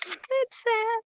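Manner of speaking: high, soft little whimper; mock sad. Teacher acting out sad for class, mock-pitiful.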